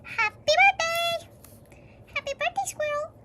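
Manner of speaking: high, bright birthday greeting; cheery birthday call. Acted. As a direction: unicorn surprising the squirrel with a wish. Unicorn grants birthday wish.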